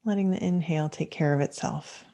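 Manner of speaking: unhurried meditation guidance with long pauses; calm, measured mindfulness teacher leading a practice; guided meditation. Acted. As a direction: guide listeners gently into stillness, leaving space between phrases.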